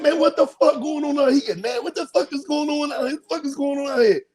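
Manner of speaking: high-pitched imitated bewilderment; mock outrage. Acts out the men's shocked outcry.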